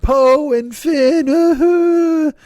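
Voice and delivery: very slow, high, drawn-out knowing fan voice; mock shipper fan. Mocking Star Wars fans who ship Poe and Finn, drawn-out smug voice. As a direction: stretch it out like a smug superfan. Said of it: "there are people like".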